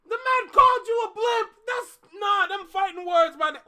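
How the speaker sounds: high, loud, outraged; hyped shout. Real, not acted. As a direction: yell it like a fight's about to start. Outraged hype at the insult.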